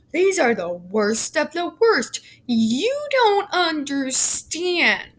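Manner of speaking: high, slow, sneering parody of the officials; mocking JRC voice. Host mocking the JRC's justification in a high, slow sneer. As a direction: ham up their self-righteous excuse. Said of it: "That hard line the J R C wants us to just swallow".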